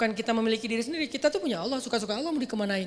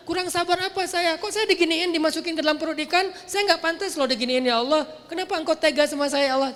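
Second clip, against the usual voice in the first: higher, louder aggrieved protest; indignant complaint; 6 semitones higher, 4 dB louder than usual. Pretend. Imagining Yunus protesting to Allah, indignant and loud. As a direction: protest like someone wronged.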